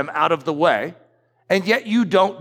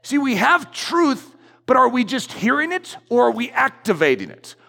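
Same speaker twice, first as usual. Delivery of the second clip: normal talking voice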